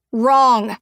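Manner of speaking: loud sharp shout. A narrator's reading. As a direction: cut him off loudly. Julia slams hand on table.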